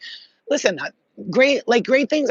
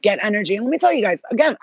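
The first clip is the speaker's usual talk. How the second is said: usual speaking voice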